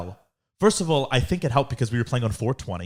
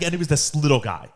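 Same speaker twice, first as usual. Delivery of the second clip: usual speaking voice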